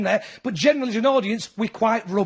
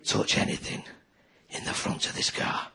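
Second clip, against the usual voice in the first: put-on dad threatening the kids in the back of the car; Lee Van Cleef menace; 10 dB quieter than usual. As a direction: growl it like a spaghetti western villain. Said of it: "Lee Van Cleef".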